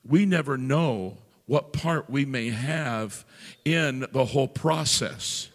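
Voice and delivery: loud, slow, ringing preacher's climax; preacher building to a loud, slow climax; full-force preaching. Real, not acted. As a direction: drive the point home to the congregation.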